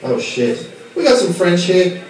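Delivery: ordinary talk, nothing marked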